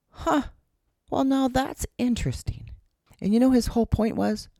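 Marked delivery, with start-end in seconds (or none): 0.1-2.7 "Huh" pitched far higher, lightly amused and unbothered; bemused mentor. Retold or imitated. Mentor watching his mailbox get clipped, amused and unbothered. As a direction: imitate a calm, curious shrug.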